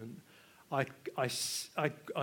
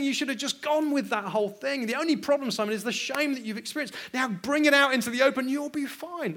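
higher, louder mocking voice of a liberal adviser; mocking permissive critic; 10 semitones higher, 7 dB louder than usual. Preacher mimics critics lecturing young Simon, higher and louder. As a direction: lampoon the critics telling him to indulge. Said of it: "lots of people would say".

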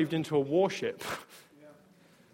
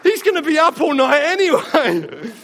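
louder, higher punchline through laughter; laughing repeat; 7 semitones higher, 9 dB louder than usual. Real, not acted.

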